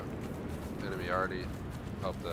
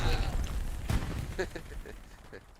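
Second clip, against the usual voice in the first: breathy laugh; 7 semitones higher, 4 dB louder than usual. Real, not acted. Laughs after dying.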